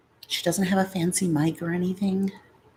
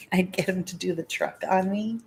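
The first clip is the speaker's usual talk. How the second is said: ordinary delivery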